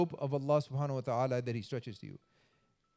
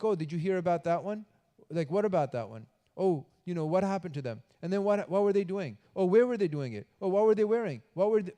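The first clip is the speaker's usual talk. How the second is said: eager nosy gossiper, rising and louder; 3 semitones higher, 3 dB louder than usual. Pretend. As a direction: pile on the questions like a busybody. Gossiper acted out, nosy questions.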